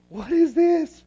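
high, loud mock disbelief; a dad baffled by his son's antics, voice rising; incredulous dad. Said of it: "Dad was just on the sidelines going".

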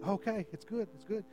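soft, hushed self-encouragement; reassuring oneself. Acted. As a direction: quietly talk yourself into it. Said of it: "You stepped out on it".

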